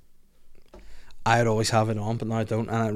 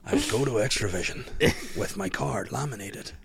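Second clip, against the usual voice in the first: Liam Neeson; 5 dB quieter than usual. Pretend. Solemnly recalling trips to the video shop, hammy Irish accent. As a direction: play the solemn Irish storyteller, unhurried and soft. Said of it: "No, Liam, please don't".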